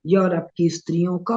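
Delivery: normal talking voice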